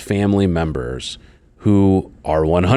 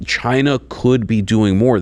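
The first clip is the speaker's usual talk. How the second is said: ordinary talk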